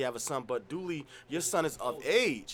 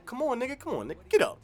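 dad pushing; 10 semitones higher, faster than usual. Retold or imitated. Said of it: "you need a dad".

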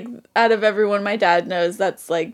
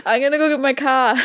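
put-on joking New England accent, a bit high; 4 semitones higher than usual. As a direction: drop the R in car like a New Englander. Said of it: "such a thick New England accent".

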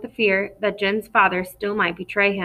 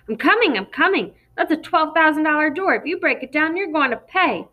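yelled out higher, through a door; yelling; 7 semitones higher than usual. A narrator's reading. Jen's dad yells at the police outside the door, higher and annoyed. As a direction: shout the warning back at them. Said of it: "Jen's dad yelling".

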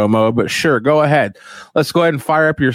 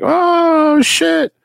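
much higher mock awestruck fan voice; high gasping fan reaction; fans gasping oh shit; much slower than usual. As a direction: squeal it like a starstruck follower. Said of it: "And people are like".

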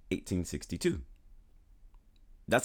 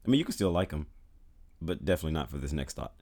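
ordinary talk, nothing marked

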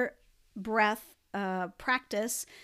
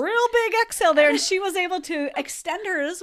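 laughing; 6 semitones higher, 5 dB louder than usual. Real, not acted. Laughing after Lexi laughed.

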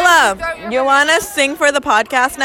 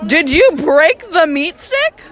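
usual speaking voice

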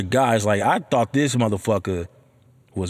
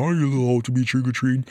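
snooty neighbor; faster than usual. Retold or imitated. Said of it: "have the fucking nerve to ask me".

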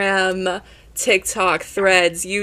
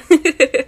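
burst of laughter; 8 semitones higher, 7 dB louder than usual. Real, not acted. Host laughs after heartfelt praise.

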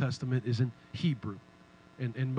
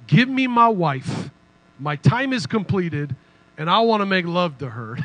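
forceful demand; 3 semitones higher, 8 dB louder than usual. Acted. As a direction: read it like a man demanding what he's owed. Jacob demanding his bride.

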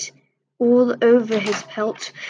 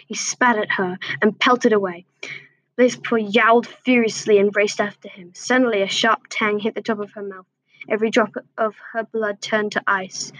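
ordinary delivery, nothing marked

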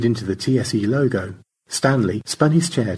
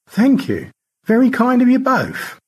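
put-on a much higher genteel old voice; gracious high thanks; George; 5 dB louder than usual. George thanks both rescuers, genteel high voice.

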